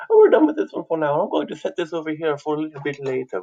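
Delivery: high, put-on TV presenter voice; cooking show host. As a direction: play a cooking show host setting a dish aside. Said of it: "like, one of those cooking shows".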